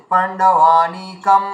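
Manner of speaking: পাণ্ডবানিকম said very slowly with a closed m at the end; drawn-out পাণ্ডবানিকম with final m; teacher showing the m ending. Shown as a demonstration. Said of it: "দ্বিতীয়তে ম বলা যায় এখানে".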